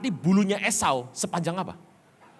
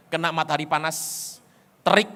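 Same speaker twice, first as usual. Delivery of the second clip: usual speaking voice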